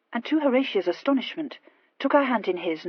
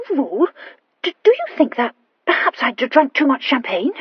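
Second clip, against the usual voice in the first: high, loud, halting question; stammering Horatia, puzzled; 6 semitones higher, 9 dB louder than usual. Acted.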